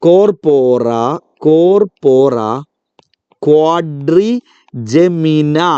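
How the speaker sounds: corpora, corpora, then quadrigemina, each piece drawn out with pauses; broken-up slow drill. Shown as a demonstration. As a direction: break the name into chunks for children. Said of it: "നല്ല പേര് അല്ലേ?".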